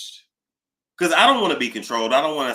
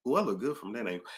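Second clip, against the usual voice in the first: usual speaking voice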